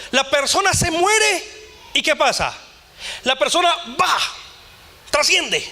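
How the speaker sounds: preaching at full force. Acted. Pastor preaching to the church about death, high and ringing. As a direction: preach it out to the back row.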